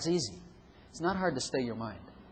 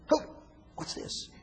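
mock surprise; 6 dB quieter than usual. Mock shock at the flesh.

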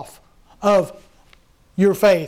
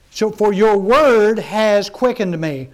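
slow scripture reading; slower than usual. Acted. Preacher reading the verse to his congregation, slow and solemn.

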